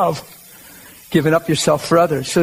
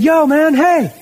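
high, louder call out the car window; 9 semitones higher, 3 dB louder, slower than usual. Retold or imitated. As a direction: hail a stranger across the street. Friend calls out car window.

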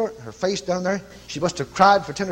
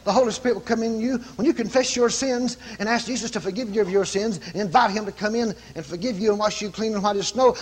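usual speaking voice

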